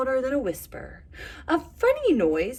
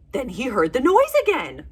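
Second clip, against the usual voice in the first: ordinary delivery, nothing marked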